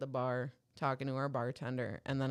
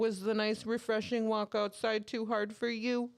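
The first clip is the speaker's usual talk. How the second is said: higher-pitched teasing question; mock concern; 6 semitones higher than usual. Dudes at the bar teasing a comedian, mock concern. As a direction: needle him as if he were delicate. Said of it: "start kinda roasting this dude".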